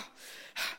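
heavy breath out after an outburst; spent sigh. Acted. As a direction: let the anger out in one sigh. Spent after getting anger out.

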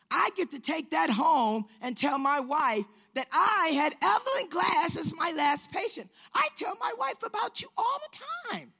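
high, loud, climbing excitement; imitating an overjoyed man; thrilled gushing doctor. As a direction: gush proudly, pitch soaring as you go. Doctor gushes to favorite patient.

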